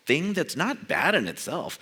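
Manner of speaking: normal talking voice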